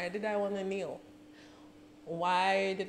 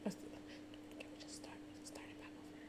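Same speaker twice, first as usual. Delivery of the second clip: overwhelmed whisper; 22 dB quieter than usual. Real, not acted. Fan asking to restart the song, faint whisper. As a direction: whisper it as if too overcome to speak.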